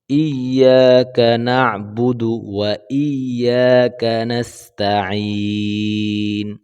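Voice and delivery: ইয়াকা with a pushed ই; stressed ee. Shown as a demonstration. Teacher showing a stressed ই. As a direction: push the ই in ইয়াকা. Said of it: "ধাক্কা দিয়ে বলবো".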